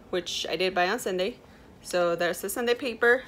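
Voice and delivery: ordinary talk, nothing marked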